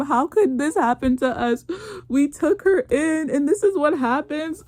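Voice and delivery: a hint of a self-pitying mother-in-law; mock self-pity. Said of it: "they're talking about some".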